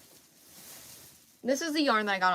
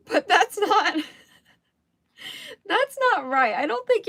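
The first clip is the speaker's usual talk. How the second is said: high, loud, laughing through the words; 11 semitones higher, 9 dB louder than usual. Real, not acted. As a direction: crack up at your own slip. Cracks up at own mispronunciation.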